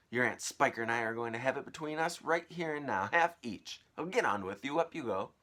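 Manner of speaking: loud, commanding aunt; bossy aunt. Acted. Aunt ordering James up the tree, loud. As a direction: order the boy about.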